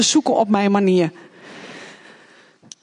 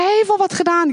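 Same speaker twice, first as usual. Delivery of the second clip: indignant protest; 9 semitones higher than usual. Retold or imitated. Protesting to police.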